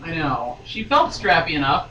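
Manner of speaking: ordinary delivery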